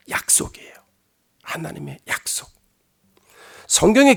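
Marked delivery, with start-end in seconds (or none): 0.1-2.5 hushed whisper, almost no voice. Real, not acted. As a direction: breathe the promise out like a secret. Preacher drops to a whisper.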